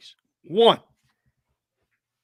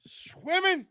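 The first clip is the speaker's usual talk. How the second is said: high mock echo; 4 dB quieter than usual.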